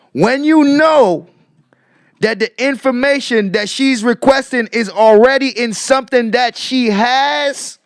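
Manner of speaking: real insistent, emphatic questioning; emphatic pressing. Real, not acted. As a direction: push the leading question hard, high and forceful. Pressing the audience with leading questions.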